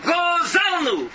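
a preacher's long wailing cry; drawn-out cry. Acted. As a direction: stretch Gozalnu out like a cry from the pulpit. Preacher cries out the confession.

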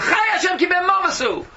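high, loud vow; indignant royal outburst; fierce oath. Acted. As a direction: swear it in a burst of anger. David's oath, acted out.

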